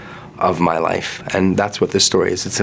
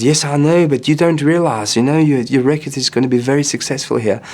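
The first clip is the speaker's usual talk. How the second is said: put-on higher, animated South African accent; South African friend; 6 semitones higher than usual. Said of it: "You've nailed the accent".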